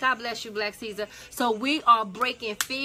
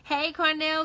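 usual speaking voice

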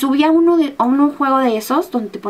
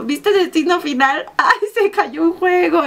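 teasing friend; 5 semitones higher, slower than usual. Retold or imitated. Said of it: "le digo".

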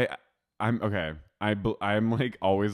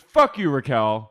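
loud, raised retort; mock angry shout; 4 semitones higher, 9 dB louder than usual. Katie dismissing Raquel, loud mock anger. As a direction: imitate a furious dismissal at full volume. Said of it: "She goes".

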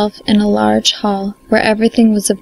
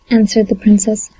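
ordinary delivery

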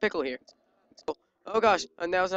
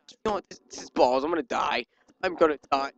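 ordinary talk, nothing marked

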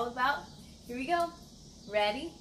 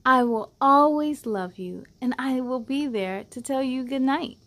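louder, expressive picture book reading; reading to children; 10 dB louder than usual. Acted. Host reading a loving picture book to kids, expressive and louder. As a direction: read it out like a bedtime story for little ones.

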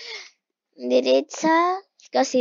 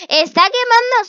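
shocked exclaim; 10 semitones higher, 11 dB louder than usual. Real, not acted. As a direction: announce the shock. Sees grandma burn the house. (exclamación de asombro)

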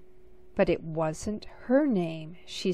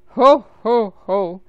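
booming, much louder ho ho ho; Santa laughing; 13 dB louder than usual. A narrator's reading. Santa laughing as the sleigh speeds past the stars. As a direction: let out a huge jolly laugh. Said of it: "Santa laughed".

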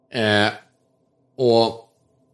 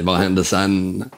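ordinary talk, nothing marked

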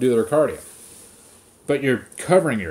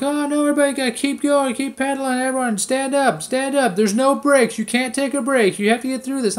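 high, whiny mock instructor voice; 11 semitones higher than usual. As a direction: parody the bossy teacher, pitched way up. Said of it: "then she'll be like".

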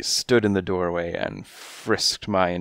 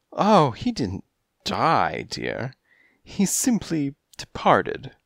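high, coy feline voice; high kittenish client voice; coy cat lady; 6 semitones higher than usual. A narrator's reading. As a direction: purr it like a kittenish femme fatale.

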